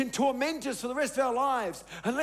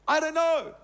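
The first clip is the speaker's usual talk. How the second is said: higher, louder I don't know, stressed; emphatic repeat; 5 semitones higher, 5 dB louder than usual. Real, not acted. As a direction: hit it hard the second time. Repeats the admission, much stronger.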